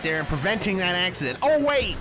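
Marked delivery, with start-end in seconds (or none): 1.4-2.0 high, loud startled cry; sudden surprise. Real, not acted. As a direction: jolt up in real surprise.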